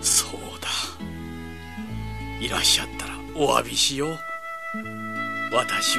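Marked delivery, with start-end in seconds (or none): 0.0-0.7 whispering. Acted. Old man whispers to himself.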